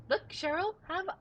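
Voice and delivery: high, sing-song taunting; teasing. Real, not acted. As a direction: dangle the snack playfully. Teasing with a goldfish.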